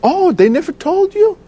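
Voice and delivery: very high-pitched, astonished retold question; imitated surprised nurse. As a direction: voice a nurse amazed you haven't heard. Voices the nurse's surprised reply.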